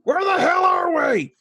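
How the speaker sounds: high startled shout. Acted. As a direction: yell it in alarm. Skull asked, shouted question.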